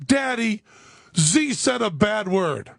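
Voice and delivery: high-pitched imitation of a sickly sweet tattletale; goody-goody little girl. Daughter telling on her brother to Daddy, mock goody-goody. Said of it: "goody-goody and kinda a little sickening".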